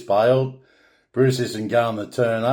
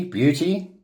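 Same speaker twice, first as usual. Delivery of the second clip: beauty sung up high with a lift; an Australian cheering beauty; Aussie cheer; 5 semitones higher than usual. Shown as a demonstration. Teaching viewers Aussie slang, a pitched-up cheer. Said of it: "most often explained as".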